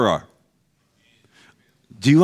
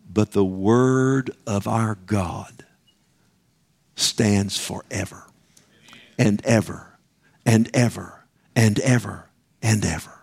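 solemn quote; 4 semitones lower, slower than usual. Acted. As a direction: let each ever hang in awe. Quoting Isaiah, building to reverence.

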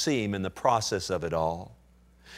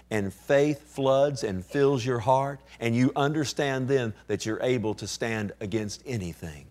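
usual speaking voice

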